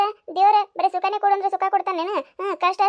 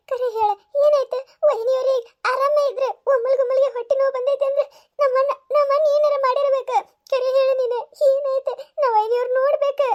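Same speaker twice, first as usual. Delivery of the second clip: anxious pleading; 5 semitones higher than usual. Acted. Lakshmi begs news of sister-in-law.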